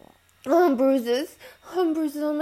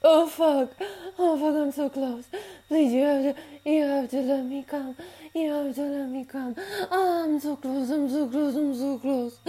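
breathless pleading. Acted. Intimate scene, pleading.

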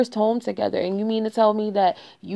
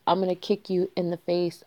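usual speaking voice

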